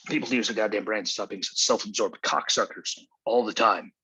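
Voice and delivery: normal talking voice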